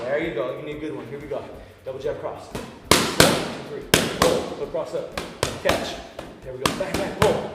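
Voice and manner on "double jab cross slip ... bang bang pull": calling combos. Shown as a demonstration. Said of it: "any cues that I have, you guys are gonna hear".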